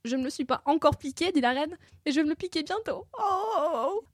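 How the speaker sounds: high theatrical sob of a queen; whimpering queen. A narrator's reading. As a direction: whine in self-pity. Queen whimpering over future pain.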